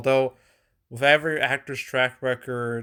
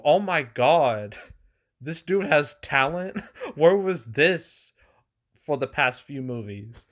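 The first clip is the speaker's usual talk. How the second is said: higher-pitched, laughing disbelief; mock amazement; 3 semitones higher than usual. Moviegoers discovering the actor has talent, mock amazement. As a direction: act out astonished moviegoers, drawn out and laughing. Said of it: "It changed the way people saw him".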